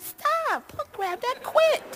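put-on very high, soft girly protest; giggly young woman. To the boyfriend, a high girly voice protesting. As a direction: play a teen girl squealing stop through giggles. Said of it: "she's like".

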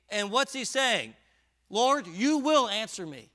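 loud, high, driving sermon voice; preaching at full force. Real, not acted. Preacher urging his flock, in a loud high push. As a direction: press the congregation hard with rising force.

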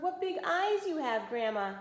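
high, scared little voice; timid wolf. Acted. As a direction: squeak it out nervously. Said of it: "he finally whispered".

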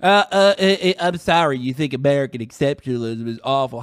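stammering dopey parody of a politician; mocking imitation. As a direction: mimic him as an idiot, stumbling then rushing. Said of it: "How fucking dumb are you".

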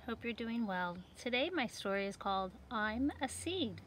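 ordinary delivery

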